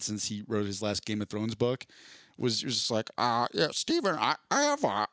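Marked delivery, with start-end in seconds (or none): 3.2-5.1 high, halting author impression; George R R Martin. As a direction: stammer it out in a high, hesitant voice. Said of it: "that was a pretty good George R R Martin".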